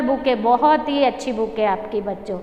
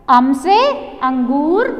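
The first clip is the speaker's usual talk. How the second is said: slow, drawn-out model phrase; teaching recitation; slower than usual. Shown as a demonstration. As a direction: stretch each word for the children. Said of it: "तो ये मैंने आपको सिखाया". (बच्चों को सिखाते हुए धीरे-धीरे खींचकर)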